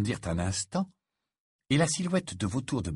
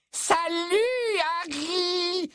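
put-on very high, gushing girlish greeting; drawn-out high-pitched infatuated hello; Romilda Vane; much slower than usual.

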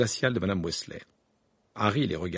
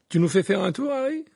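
lightly voiced Bill, higher and bright-eyed; eager question; 10 semitones higher, 5 dB louder than usual. A narrator's reading. Bill asking Harry for a tour, eager. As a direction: ask it eagerly, pitching up on Harry.

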